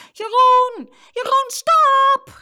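put-on high, loud, drawn-out girl's shout; alarmed shout, stretching the words; urgent cry. A narrator's reading. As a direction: cry out to halt a fight. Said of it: "riep Saskia".

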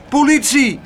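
high, loud cries for help; calling for help. Acted. Abeltje cries for help while dragged off, high and loud. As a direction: yell for rescue. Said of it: "riep hij".

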